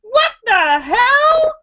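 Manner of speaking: high, slow, incredulous outburst; retold outrage. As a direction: stretch it out in high-pitched disbelief. Retelling her outraged reaction.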